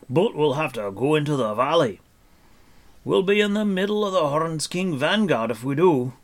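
high, loud dwarf voice; Dolly the dwarf, warning. Acted. Dwarf explains the risky valley route, warning. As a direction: warn the party in the dwarf's loud voice.

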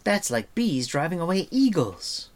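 put-on high, lively young girl; bright girl, amazed; girl's voice. A narrator's reading. Eilonwy's voice.

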